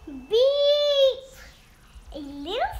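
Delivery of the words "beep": high, loud spaceship beep; imitated beep. A narrator's reading. A spaceship sounds in the garden, imitated beep. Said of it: "there was a sound".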